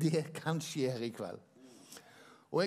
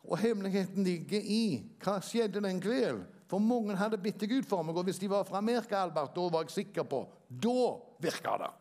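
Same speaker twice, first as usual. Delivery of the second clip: preaching; 4 semitones higher than usual. Acted. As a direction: build the testimony with fervour. Preaching testimony rising in force.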